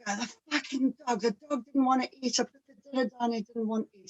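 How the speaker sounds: a hint of a sulky husband; grumpy husband. Husband complaining the dog won't eat, lightly mimicked. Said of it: "Or he'll go".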